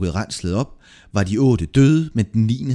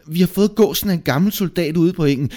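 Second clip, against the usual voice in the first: high, quick explanation; hurried high-pitched excuse; protesting journeyman; 9 semitones higher, 4 dB louder, faster than usual. A narrator's reading. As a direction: blurt out your defence, high and fast. Accused journeyman explains himself.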